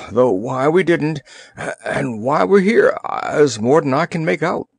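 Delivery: put-on wet, gurgling old sailor voice. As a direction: talk like water's still in your throat. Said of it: "said in a gurgling voice".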